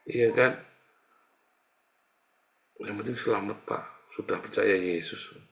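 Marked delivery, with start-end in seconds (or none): none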